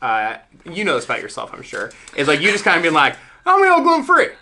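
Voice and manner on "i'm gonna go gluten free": high, loud gung-ho announcement; mock enthusiasm. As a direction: imitate a friend proudly declaring a new fad. Mocking friend's eager diet announcement.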